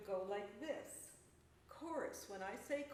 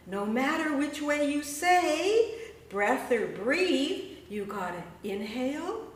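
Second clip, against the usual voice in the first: slow, louder rhythmic recitation; poem performance; 3 semitones higher, 11 dB louder, slower than usual. Acted. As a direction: chant it like spoken word. Performs his breath sound poem.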